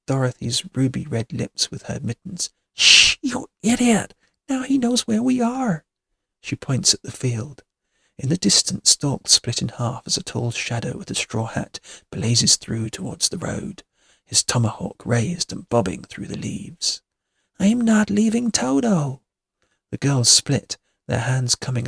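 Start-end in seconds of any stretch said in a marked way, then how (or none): 2.7-5.8 high, tense hushing rebuke; alarmed scolding. A narrator's reading. Kitty fears Tall Man heard Dorothy, alarmed. Hushing friend after loud shout. 17.6-19.2 high, insistent protest; defiant distress. A narrator's reading. Dorothy stands firm for her dog, defiant.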